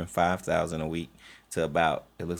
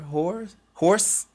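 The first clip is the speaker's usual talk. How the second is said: mock confusion; 12 semitones higher, 5 dB louder than usual. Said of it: "mishearing played up, rising".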